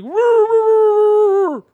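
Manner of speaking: loud high-pitched mock dog howling; dog howl imitation. Dog lover imitating a dog facing a burglar, loud howling. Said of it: "Dogs can be like".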